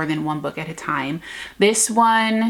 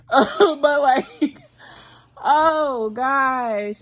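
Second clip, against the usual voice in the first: a teary laugh breaking into the words; laughing through tears; 6 semitones higher, 3 dB louder, much slower than usual. Real, not acted. Vlogger to viewers, laughing through happy tears. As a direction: laugh and cry at once, overcome. Overwhelmed by a favorite book.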